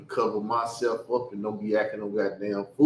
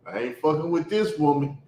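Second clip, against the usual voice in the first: ordinary talk, nothing marked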